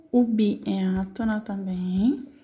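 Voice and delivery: O bi é átona também said slowly; slow explanation. Shown as a demonstration. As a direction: spell it out slowly for the class. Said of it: "naming the weak syllable". (explicando devagar a sílaba bi)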